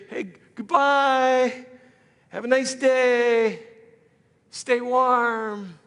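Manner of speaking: high, slow, sing-song dismissal; high, slow fake-cheerful farewell; mock breezy goodbye. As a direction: airily wave off the needy person. Brushing off the poor person.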